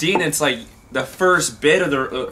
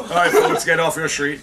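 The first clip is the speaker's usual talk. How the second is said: announcer voice; 3 semitones higher than usual. Retold or imitated. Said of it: "the guy who's announced".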